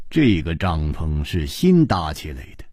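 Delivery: ordinary delivery, nothing marked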